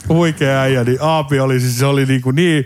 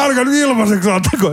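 retold shout; 9 semitones higher than usual. Someone warns friends not to give tickets away, mock yell. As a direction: imitate someone calling out in protest. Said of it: "Ja sit joku huutaa".

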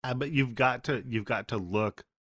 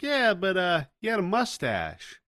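higher, eager salesman reply; 10 semitones higher than usual. Retold or imitated. Car salesman answering Kolchak, higher and eager. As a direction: chirp it back like a keen car salesman. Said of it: "It's like".

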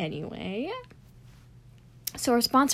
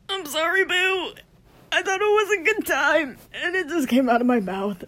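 squealy apology; 8 semitones higher, 5 dB louder than usual. Real, not acted. Exaggerated apology.

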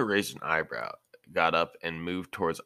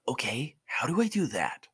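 put-on much higher, curious young voice; a puzzled kid asking for more; eager boy Naruto; 8 semitones higher than usual. Naruto asks Asuma about chakra, curious and higher.

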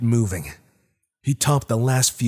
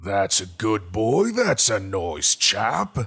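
unearthly voice; slower than usual. Acted. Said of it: "the voice called".

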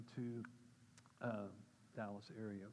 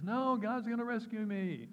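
high, loud, drawn-out refusal; hammed-up drowning man calling back; acted stubborn refusal; 9 semitones higher, 7 dB louder than usual. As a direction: shout it back, stretched and utterly sure. Drowning man refuses second rescue.

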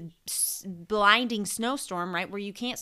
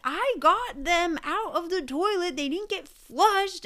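high-pitched outraged comeback, slowed; indignant retort; 10 semitones higher, 6 dB louder than usual. Retold or imitated. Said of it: "And I was like".

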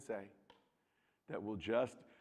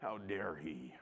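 mock indignation; 7 semitones lower, 10 dB quieter, slower than usual. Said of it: "in our anger, we go to".

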